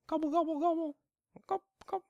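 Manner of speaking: very high gobbling chicken sounds; chicken impression. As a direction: squawk it out like a chicken. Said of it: "that's my chicken impression".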